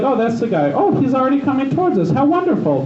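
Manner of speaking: high, mock-cheerful irony; sarcastic. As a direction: fake delight at trouble coming. Sarcastic quote of seeing Giovanni.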